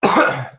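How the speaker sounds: harsh real coughing; coughing fit. Real, not acted. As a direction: cough hard, throat stuck. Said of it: "sorry, can you edit that out?".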